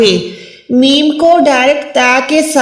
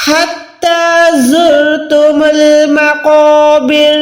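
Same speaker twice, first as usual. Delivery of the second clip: slow, measured tajweed recitation; slow Quranic recitation; much slower than usual. Shown as a demonstration. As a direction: chant it slowly with tajweed. Recites verse.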